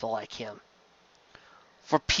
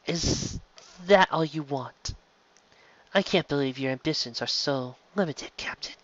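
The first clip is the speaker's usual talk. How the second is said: soft breathy taunt; teasing whisper; 7 dB quieter than usual. Acted. Ivanova teasing the captain in his cell, whispered. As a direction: lean in and needle him quietly.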